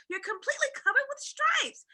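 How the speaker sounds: high, loud shocked mother; a mother shrieking in alarm; cried out. Acted. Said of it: "she cried".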